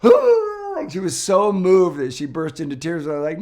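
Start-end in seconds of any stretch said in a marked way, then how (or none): 0.0-0.9 sharp, high, loud imitated gasp of surprise. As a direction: gasp like she can't believe it. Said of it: "She's like".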